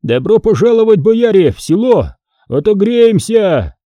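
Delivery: high, glad calling out; acted high-pitched cry of welcome; joyful hoarse welcome. A narrator's reading. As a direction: hail the guests gladly from the roadside. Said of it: "хрипло, простуженно и радостно".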